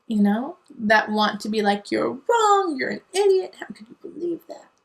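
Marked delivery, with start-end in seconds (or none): 1.9-4.7 high mocking accuser voice, ending in a whisper; mock outrage trailing into a whisper. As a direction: mimic someone scolding, then hiss the last words.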